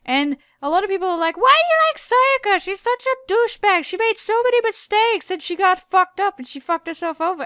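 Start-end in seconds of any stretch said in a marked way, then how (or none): 1.4-7.4 high-pitched, loud mock whining; hammed-up complaint, far above usual pitch; imitated complainers. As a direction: mimic indignant critics in a high voice. Mimicking critics of favorite character.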